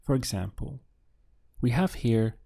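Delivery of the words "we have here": normal talking voice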